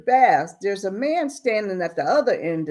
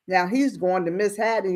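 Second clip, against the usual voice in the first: ordinary delivery, nothing marked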